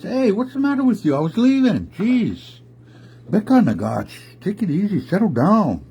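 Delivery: high, exasperated bear voice; indignant high-pitched telling-off; protesting bear. Acted. Swiped bear protests to attacker.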